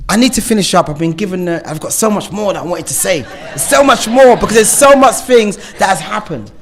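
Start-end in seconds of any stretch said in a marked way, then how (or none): 3.2-6.5 loud and high, near shouting; raised, urgent voice; passionate outburst. Real, not acted. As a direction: let it burst out of you. Passionate urge to say more.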